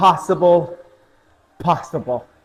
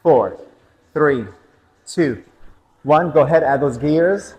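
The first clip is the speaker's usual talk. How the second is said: spin instructor call. Real, not acted. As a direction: drive the riders into the last round. Instructor calls out interval.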